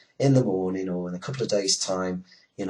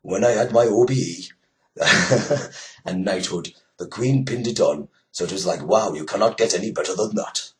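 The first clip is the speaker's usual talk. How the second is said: attempted Welsh accent; 7 dB louder than usual. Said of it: "I can't do a Welsh accent, but I'll have a go".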